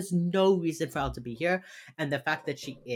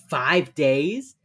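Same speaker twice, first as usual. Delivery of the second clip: ordinary talk, nothing marked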